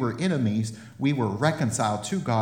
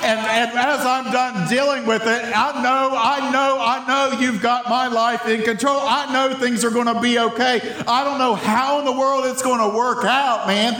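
raised, pleading prayer voice; a raised, earnest prayer to God, handing it all over; mock prayer handing it over; 10 semitones higher, 3 dB louder than usual. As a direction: talk to God like letting go of a burden. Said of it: "Maybe we should start saying".

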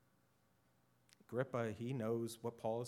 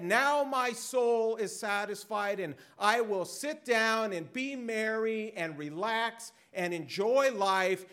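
smug rich fool; 11 semitones higher, 12 dB louder than usual. Retold or imitated. Rich fool voiced in sermon.

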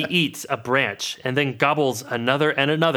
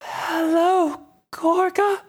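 shrill falsetto greeting, far higher; horrifying high voice; much slower than usual. Acted. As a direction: squeak it out in a tiny high voice. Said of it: "That was horrifying".

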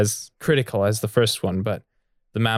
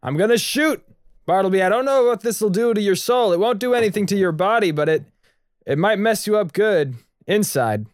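urgent warning shout; 8 semitones higher than usual. Acted. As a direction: warn him before you fire. Raphael warns Bartleby urgently.

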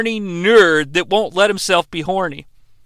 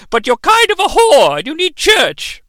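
shrill church-lady jab, lightly hammed; mock scolding grandma; 8 semitones higher than usual.

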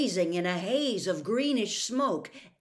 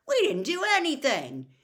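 higher, louder indignant protest; 8 semitones higher, 8 dB louder than usual. A narrator's reading. Ron rubbing his elbow, protests to his father, indignant. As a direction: protest your innocence, voice raised. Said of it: "looking indignantly at his father".

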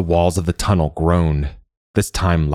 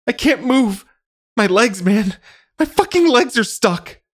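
high, panicked cries of someone trapped; shrill screaming. A narrator's reading. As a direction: scream it high, pinned and terrified. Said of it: "tried to ignore the shrill cries".

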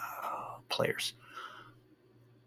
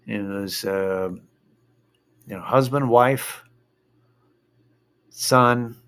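ordinary talk, nothing marked

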